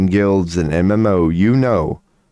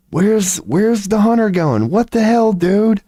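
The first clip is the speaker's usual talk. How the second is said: incredulous gamer outburst; 12 semitones higher than usual. Retold or imitated. Player reacting to hunter leaving fight.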